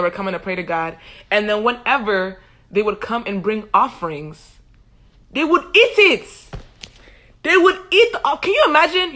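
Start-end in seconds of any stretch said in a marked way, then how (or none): 5.3-9.1 high, loud comic disbelief; scandalised outrage. Real, not acted. Storyteller to viewers about stolen offerings, loudly scandalised. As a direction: cry it out, appalled and incredulous.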